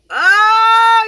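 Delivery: high, loud whiny cry; upset wail. Real, not acted. Upset soldier wailing over her pink eye. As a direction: let out a half-joking wail of dismay. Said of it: "I'm so upset".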